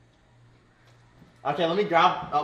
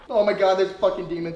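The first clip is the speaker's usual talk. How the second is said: normal talking voice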